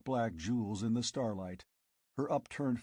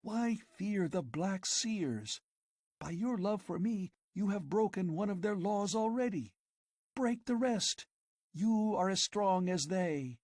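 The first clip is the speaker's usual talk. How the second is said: higher, feverish visions of riches; girl tempting her lover with an empire; passionate scheming girl; 9 semitones higher than usual.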